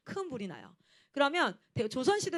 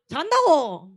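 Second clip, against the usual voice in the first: much louder snapping reply; annoyed retort; 3 semitones higher, 14 dB louder, much slower than usual. Retold or imitated. Leader snapping back at a roommate asking if she's asleep. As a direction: snap back that you're sleeping.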